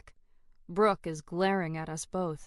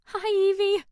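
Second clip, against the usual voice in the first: much higher and louder, grinning hello; beaming excitement; 11 semitones higher, 8 dB louder than usual. A narrator's reading. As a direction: burst out the greeting with a huge smile. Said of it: "aggressive smiling I can't seem to stop".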